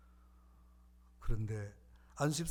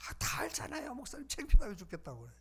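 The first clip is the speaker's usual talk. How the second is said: wife complaining; 8 semitones higher than usual. Retold or imitated. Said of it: "그랬더니".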